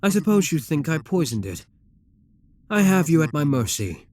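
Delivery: normal talking voice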